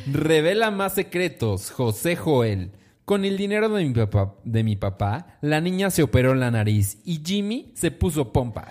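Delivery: high, loud tabloid announcer; gossip headline read. Acted. Host announces a cancelled wedding to co-host, punchy headline voice. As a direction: belt out the scandal headline.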